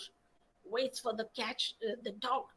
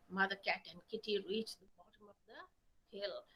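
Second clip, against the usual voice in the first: ordinary delivery, nothing marked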